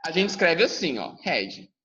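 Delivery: ordinary delivery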